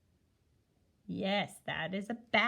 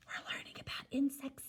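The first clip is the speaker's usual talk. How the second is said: hushed, voiceless whisper; 7 dB quieter than usual. Real, not acted. Teacher introducing the next animal to pupils, whispering. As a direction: whisper it like a little secret.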